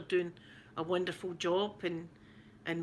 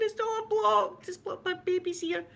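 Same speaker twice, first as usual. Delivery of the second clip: much higher, slow, lightly silly self-imitation; mocking herself; 11 semitones higher than usual. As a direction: send up her own panic in a squeaky voice. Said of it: "I was going".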